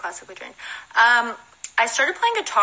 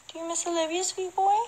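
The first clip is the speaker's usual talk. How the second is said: cooing pet voice; 10 semitones higher, 3 dB quieter than usual. Real, not acted. Godmother coos to the dog, higher and soft.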